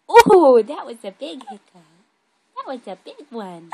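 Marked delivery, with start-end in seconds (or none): 0.1-1.6 loud, high exclamation; playful surprise. Real, not acted. Reacting to baby's big hiccup.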